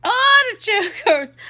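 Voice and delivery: put-on high, loud, drawn-out comic accent; put-on Edith Bunker accent. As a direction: squawk it slowly in a hammed-up accent. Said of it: "why I do this accent".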